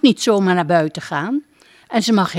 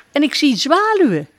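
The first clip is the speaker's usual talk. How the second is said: higher duck voice; proud duck; 8 semitones higher, 3 dB louder, faster than usual. Acted. Duck naming swallows, higher voice.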